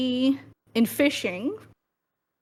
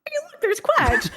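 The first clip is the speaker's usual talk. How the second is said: excited spotting. Retold or imitated. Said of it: "and I'm like".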